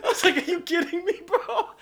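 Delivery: very high, incredulous laughing retelling; laughing disbelief. Retold or imitated. Teammate reacting to Mike getting drilled, laughing disbelief.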